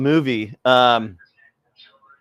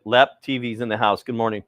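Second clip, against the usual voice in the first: ordinary talk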